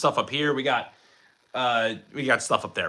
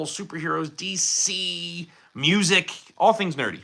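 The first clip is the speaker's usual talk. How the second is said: ordinary delivery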